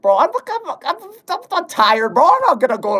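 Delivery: high squeaky voice. Acted. Protest to go-to-sleep comment.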